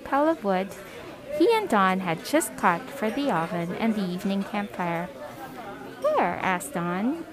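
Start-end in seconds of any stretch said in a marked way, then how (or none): 6.0-6.4 high-pitched, eager one-word question. A narrator's reading. Don asks Father where the helpers are, eagerly. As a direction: ask it eagerly, pitched well up. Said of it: "eagerly looking up the trail".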